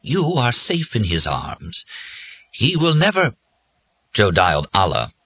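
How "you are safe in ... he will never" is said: high, slow, floating padre voice; dreamy, gentle reassurance; faraway, gentle. A narrator's reading. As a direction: soothe tenderly from far away. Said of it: "in a faraway, gentle voice".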